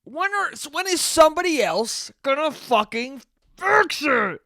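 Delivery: high, hammed-up exasperated demand; angry whining. Customer waiting for someone to fix the power, angry whining. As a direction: act out a helpless, angry complaint. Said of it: "You just sit there angry".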